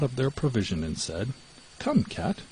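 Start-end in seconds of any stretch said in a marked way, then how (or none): 1.8-2.4 cheerful call. Acted. Mouse calls cheerfully.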